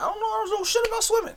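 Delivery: high, loud burst of disbelief; exasperated outburst. Real, not acted. Host tells guest he knows nothing about swimming, high loud outburst.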